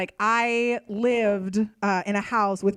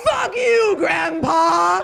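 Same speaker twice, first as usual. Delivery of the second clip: much higher, louder yelled taunt; gloating shout; 8 semitones higher, 8 dB louder, much slower than usual. Retold or imitated. Narrator taunting the dead grandpa, a loud high yell. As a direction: shout it in triumph.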